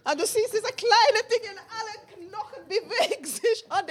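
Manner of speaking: high, quiet, marvelling over a newborn; light laughing wonder at the tiny baby; delighted wonder. Acted. Preacher marvelling at a newborn's first movements, high and soft.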